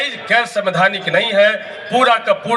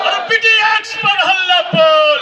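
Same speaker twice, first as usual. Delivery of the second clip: shouted slogan; 10 semitones higher than usual. Acted. Leader rouses the rally crowd, shouted slogan chant. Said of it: "इतना जोर से हल्ला बोलना होगा".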